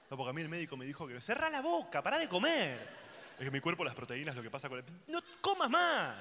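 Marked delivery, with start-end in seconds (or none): 1.2-3.0 high, slowing, emphatic telling-off; exasperated order, pitched up; exasperated scolding. Acted. Acted scolding of the glutton. 5.1-6.2 high, loud, drawn-out order; slow, pitched-up exasperated yell; exasperated outburst. Acted. Acted outburst at the glutton.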